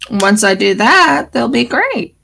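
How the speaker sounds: ordinary delivery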